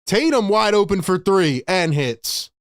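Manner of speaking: ordinary talk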